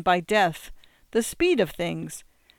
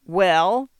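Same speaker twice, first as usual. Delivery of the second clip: louder insistent well with a rise; demanding; 6 dB louder than usual. A narrator's reading. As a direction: press him for an answer, loud. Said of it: "demands Aunt Rhoda".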